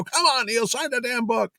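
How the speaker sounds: high-pitched, exasperated pleading; retold arguing. Organizer urging the artist to sign, acted exasperated arguing. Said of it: "we start fighting about it".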